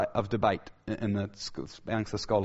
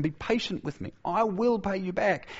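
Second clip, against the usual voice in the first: higher-pitched, lightly acted plea; begging; 8 semitones higher than usual. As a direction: plead as the servant, raised pitch without extra volume. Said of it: "fell to his knees and begged him".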